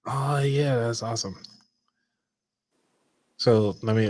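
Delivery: normal talking voice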